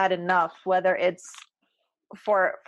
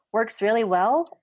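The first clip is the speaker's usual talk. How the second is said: normal talking voice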